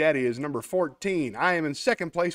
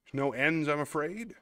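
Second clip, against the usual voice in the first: ordinary delivery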